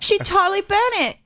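high-pitched pained Aussie whine; Phoebe, Australian accent.

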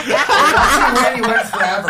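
high, loud laughing start. Real, not acted. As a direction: laugh while teasing her. Host laughs at guest.

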